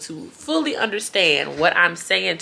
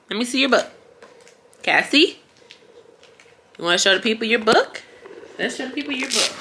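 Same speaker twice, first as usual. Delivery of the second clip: put-on high singsong toddler talk; baby talk; 8 semitones higher, 3 dB louder than usual. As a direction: coo at the little one. Sitter voicing toddler, then baby talk.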